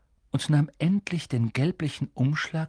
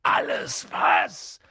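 slow, low rough roar of a giant; drawn-out growling bellow; thundering; 8 semitones lower, much slower than usual. A narrator's reading. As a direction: thunder it back in disbelief. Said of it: "donnerte Hagrid".

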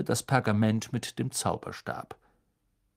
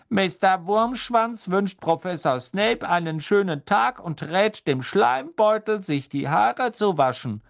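higher, louder cheeky tone; mocking map voice; 9 semitones higher, 6 dB louder than usual. The map insulting Snape in a cheeky voice. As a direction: read it like a prank note.

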